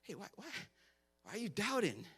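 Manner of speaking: gently calm frightened friends; soothing. Retold or imitated. Jesus calming his scared disciples, soft soothing voice. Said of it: "It's like".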